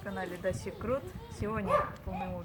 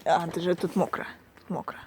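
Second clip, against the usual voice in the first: normal talking voice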